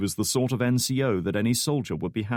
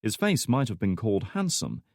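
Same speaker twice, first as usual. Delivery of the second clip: usual speaking voice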